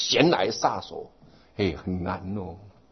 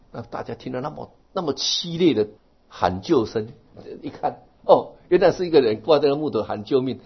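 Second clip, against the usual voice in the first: ordinary delivery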